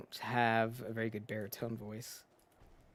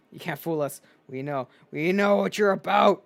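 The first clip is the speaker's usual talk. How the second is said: angry character voice; 6 dB louder than usual. Acted. Reads hostile character's lines.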